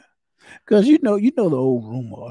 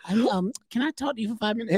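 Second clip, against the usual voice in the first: mock teen; 8 semitones higher, 3 dB quieter, faster than usual. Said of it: "if I ever hear it come out their mouth".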